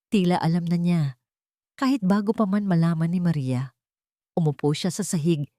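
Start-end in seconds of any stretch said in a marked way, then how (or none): none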